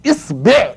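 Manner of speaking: "إصبع" with i then e, isbeʕ; pronunciation variant. Shown as a demonstration. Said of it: "يا تفتحها يا تكسرها".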